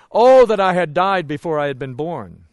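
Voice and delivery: loud imitated cry of anguish; groaning lament. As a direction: groan it out loudly, as Job lamenting. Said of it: "I would hear his groan".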